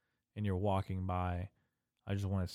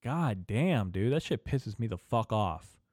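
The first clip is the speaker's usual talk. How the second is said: ordinary delivery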